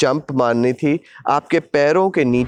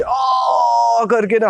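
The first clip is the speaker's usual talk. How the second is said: excited shout; 8 semitones higher, much slower than usual. Retold or imitated. Acting out the free fall.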